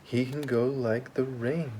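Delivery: usual speaking voice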